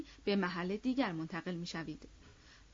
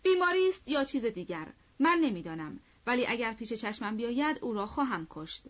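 loud, furious outburst; angry shout; 3 semitones higher, 5 dB louder than usual. Acted. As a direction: Denisov yells in rage at the absent officer. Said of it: "دنیسوف غضبناک فریاد کشید".

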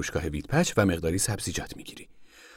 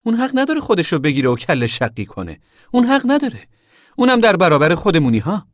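high, indignant outburst; outraged raised voice to a committee; angry; 9 semitones higher, 5 dB louder than usual. A narrator's reading. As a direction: protest furiously, climbing louder. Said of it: "جسی با لحنی خشمگین خطاب به کمیته گفت".

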